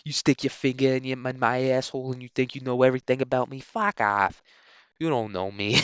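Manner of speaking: defiant patient mouthing off at his doctor, put-on voice; Good Will Hunting. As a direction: play an indignant patient telling off the doc. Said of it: "acting like Good Will Hunting".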